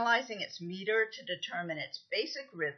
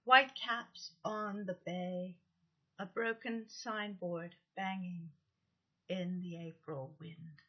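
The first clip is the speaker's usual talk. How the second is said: slow, measured poem reading; 4 dB quieter, slower than usual. Shown as a demonstration. Teacher reading a haiku aloud to the class, slow and measured. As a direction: read the haiku slowly and softly at the end.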